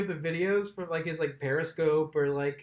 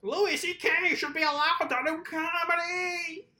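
mock protester; 10 semitones higher than usual. Mocking imagined protesters' outcry.

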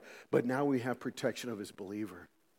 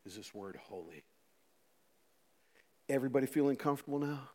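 usual speaking voice

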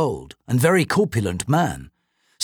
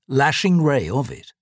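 ordinary delivery

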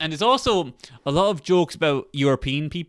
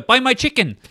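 high, loud mock vendor cry; insistent Vietnamese vendor; 9 semitones higher, 7 dB louder than usual. Retelling chicken seller hassling men on boat, insistent cry. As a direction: imitate a vendor pestering, pitched way up.

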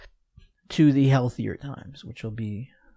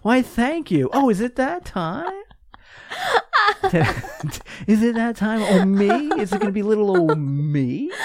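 hammy stage actor; 7 semitones higher than usual. Pretend. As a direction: ham it up like a flattered diva. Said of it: "I'll act like someone else said it".

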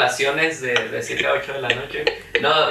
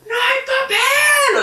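put-on high, drawn-out little kid shout through a laugh; child calling out for toilet paper; kid yelling; 3 dB louder, much slower than usual. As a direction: yell it like a small kid stuck in the toilet. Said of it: "yo gritaba".

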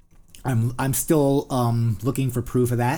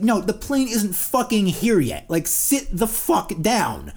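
higher, slow, fed-up scolding; mock gate agent snap; 9 semitones higher, 4 dB louder, slower than usual. Gate agent to impatient passengers, fed-up scolding. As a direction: bark it at the crowd, exasperated. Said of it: "the people at the gate are like".

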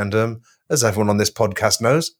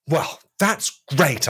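sarcastic; 6 semitones higher, much slower than usual. Acted. Sarcastic remark to Jesus.

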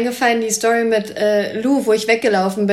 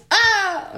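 high hammed-up yelp; mock stunt scream; fake fall scream; 11 semitones higher than usual.

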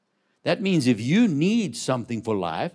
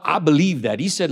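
ordinary talk, nothing marked

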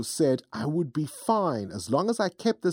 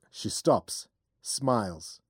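ordinary delivery

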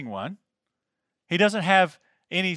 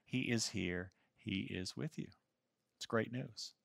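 hushed intimate; 7 semitones lower, 15 dB quieter than usual. Real, not acted. Sermon drops to an intimate close.

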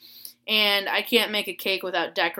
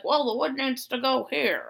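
higher put-on grandpa voice; grandpa impression; 6 semitones higher than usual. Grandpa explains the window unit, grandpa impression. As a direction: play grandpa explaining, pitched up. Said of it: "And he's like".

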